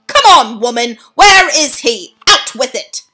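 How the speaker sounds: put-on father yelling for his missing boy; angry shout. A narrator's reading. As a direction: bark at the woman. Father demanding where his son is.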